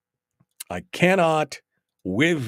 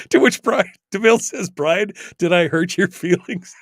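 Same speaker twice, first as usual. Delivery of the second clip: laughing; 6 semitones higher, 6 dB louder than usual. Real, not acted.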